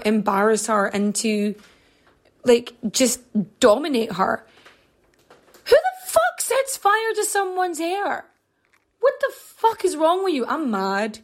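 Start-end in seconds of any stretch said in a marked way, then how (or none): 5.7-10.5 raised, higher outraged disbelief; outraged rant. Real, not acted. Angry host ranting about Vito burning her hair, outraged. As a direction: vent real fury at Vito. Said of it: "Clearly I'm angry".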